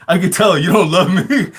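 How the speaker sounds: high teasing line through laughter; wife jokingly guilt-tripping him, laughing; laughing tease. Retold or imitated. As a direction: play the hurt wife while cracking up.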